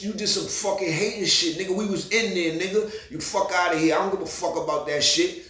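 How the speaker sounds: squaring up to the big bouncer, yelling; retold heated yell. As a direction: stand your ground and yell it in his face. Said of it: "I said".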